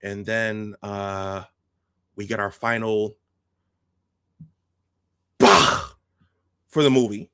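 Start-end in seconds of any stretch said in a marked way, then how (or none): 5.4-5.9 loud, harsh voiceless burst; Kroog. Retold or imitated. Kroog's final bah for the movie, explosive. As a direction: spit out one explosive 'bah' with feeling. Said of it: "said that one with a lot of".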